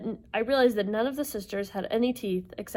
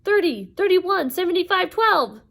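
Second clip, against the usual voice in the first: high, loud rapid-fire call; belted out; 10 semitones higher, 8 dB louder than usual. Acted. Sisters giving Percy the numbers, belting them out. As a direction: belt it out like a quarterback calling a play. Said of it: "belted out like a quarterback calling a play".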